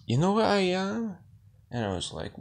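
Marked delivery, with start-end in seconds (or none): 0.1-1.2 much higher, louder mock drunk Irish guy; Irish bar guy. Imitating the Irish guy at the bar, a much higher, louder mock drunk voice. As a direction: puff up like a tipsy stranger at the bar. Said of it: "an Irish guy who was like".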